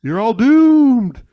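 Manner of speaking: slow, loud, high doom-laden warning; ominous warning. Retold or imitated. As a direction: drawl it out like a crazy prophet. Impression of Crazy Ralph's warning.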